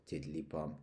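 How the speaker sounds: plain reading voice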